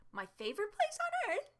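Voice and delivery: sarcastic. Retold or imitated. Mocking Walmart while begging her boyfriend, high sarcastic voice. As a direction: sneer it like Walmart is paradise.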